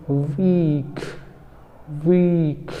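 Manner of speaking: teacher demo of वीक; long ee. Shown as a demonstration. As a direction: stretch the ee in वीक. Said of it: "उसको क्या बोलेंगे?".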